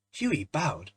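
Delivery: ordinary delivery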